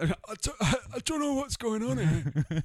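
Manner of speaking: high, slow, put-on bewildered voice; mock confused voice. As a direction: play a baffled bloke, drawn out. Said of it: "he's like".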